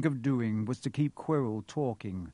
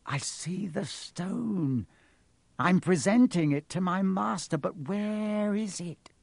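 high, slow, hungry villain; high-pitched obsessed muttering to oneself; villain Quirrell, entranced; 7 semitones higher than usual. A narrator's reading. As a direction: draw it out, greedy and searching. Quirrell stares hungrily into the mirror.

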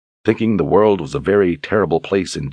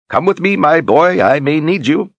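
put-on high, loud eager old man; a high, brisk old mentor's voice; old wizard Zed; 11 semitones higher, 5 dB louder than usual. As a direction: call the boy along like a spry old wizard.

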